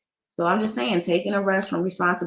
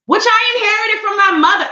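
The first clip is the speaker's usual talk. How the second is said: playful high; 13 semitones higher, 4 dB louder than usual. Real, not acted. Playful boast about her lips.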